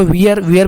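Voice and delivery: वियर with an "ee" vowel, like "wee-er"; mispronounced "were". Shown as a demonstration. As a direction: say वियर with ee gliding into r. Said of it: "भी नहीं पढ़ेंगे".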